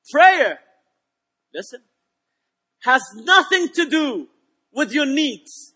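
deliberate, emphatic sermon delivery with big pauses; preaching. Real, not acted. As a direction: punch the opening word loud, then pause. Preaches at full force.

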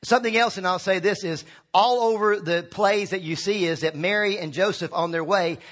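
ordinary talk

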